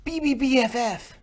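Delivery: high, loud, gushing greeting; excited squeal. Acted. Sister hugging her big brother, excited high squeal. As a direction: burst out the letters with glee.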